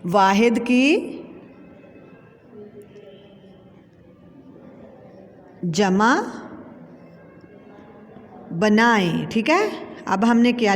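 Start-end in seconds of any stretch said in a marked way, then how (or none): 0.1-9.3 slow with long pauses between "वाहिद की", "जमा" and "बनाएं"; dictation pace. Shown as a demonstration. Teacher dictating the question to pupils, slow with pauses.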